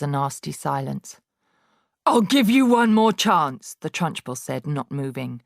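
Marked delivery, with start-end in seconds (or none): 2.1-3.8 louder, higher final warning; menacing warning. A narrator's reading. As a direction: give one last icy chance. Trunchbull warning, not moving.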